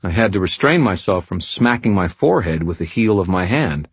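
usual speaking voice

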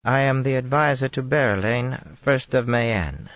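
lightly voiced, poised Tarabana accent; composed Tarabana accent. A narrator's reading. Aes Sedai introducing herself to the Dragon, composed Tarabana accent. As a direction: reclaim dignity in Tarabana accent. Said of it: "as a favor".

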